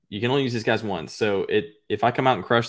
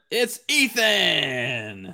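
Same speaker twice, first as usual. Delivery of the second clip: slow mock reveal; 5 semitones higher, much slower than usual.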